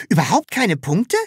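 alarmed. A narrator's reading. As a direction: ask it with a jolt of alarm. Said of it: "fragte das Sams erschrocken".

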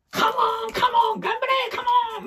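high shouted カモン, カモン; cheering shout. Retold or imitated. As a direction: shout them on from the sidelines.

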